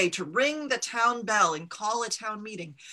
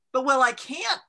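alarmed protest; 5 semitones higher, 6 dB louder than usual. A narrator's reading.

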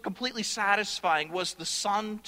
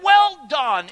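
booming praise; 6 semitones higher, 11 dB louder than usual. Acted. Preacher voices the Father's approval.

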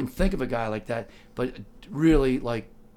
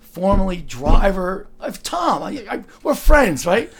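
slow, louder, over-insistent claim of friendship; a slightly drunk fan pleading his case; tipsy insisting; 6 semitones higher, 8 dB louder, slower than usual. Retold or imitated. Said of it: "I go".

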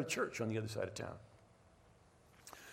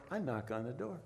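ordinary talk

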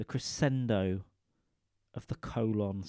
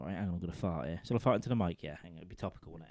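ordinary talk

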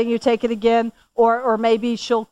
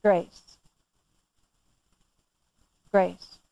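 reverent; 6 semitones lower, 4 dB quieter than usual. Real, not acted. Preacher pauses, savors word.

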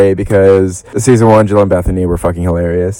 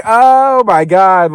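stunned; 10 semitones higher, much slower than usual. Real, not acted. Shocked reaction to trailer.

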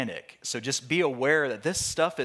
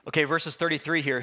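ordinary delivery

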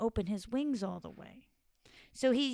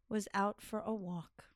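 ordinary delivery, nothing marked

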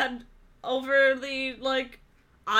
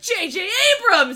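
high, loud announcement; excited; 9 semitones higher, 9 dB louder than usual. Retold or imitated. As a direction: shout the name with glee. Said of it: "And you were like".

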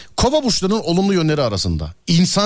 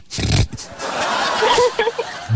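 laugh burst. Real, not acted.

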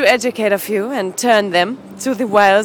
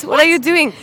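ordinary delivery

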